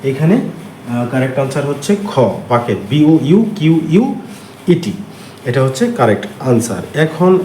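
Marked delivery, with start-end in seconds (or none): 2.9-4.9 slow letter-by-letter spelling; teacher slowly spelling a word. Shown as a demonstration.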